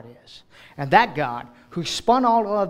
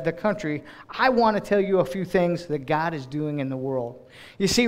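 normal talking voice

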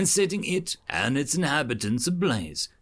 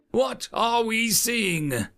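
slow, higher lecturing question; quizzing instructor; 10 semitones higher, much slower than usual. Acted. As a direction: put it to the class. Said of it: "the voice says".

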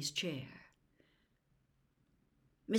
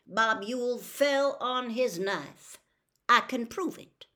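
firm, slow, louder lawman's voice; sheriff insisting; 4 semitones higher, 7 dB louder than usual. Acted. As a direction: lay it down as settled fact. Tate insists on his story.

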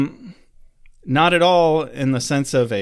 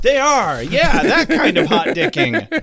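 laughing; 10 semitones higher, 4 dB louder than usual. Real, not acted. Laughing at dick joke.

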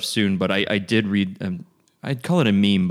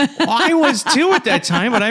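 laughing; 13 semitones higher, 4 dB louder, slower than usual. Real, not acted. Laughing reply.

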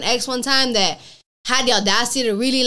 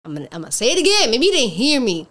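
normal talking voice

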